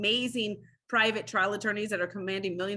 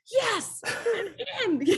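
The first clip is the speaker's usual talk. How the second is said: much higher, thrilled yes; eager excitement; 14 semitones higher, much slower than usual. Retold or imitated. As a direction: jump at the chance, giddy. Eager to join murder trial.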